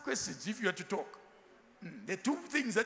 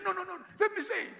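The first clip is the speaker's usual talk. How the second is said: higher, fast, laughing interruption; mock chatterbox; 11 semitones higher, 4 dB louder, faster than usual. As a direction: play the talker who won't let you speak. Quoting the chatty passenger.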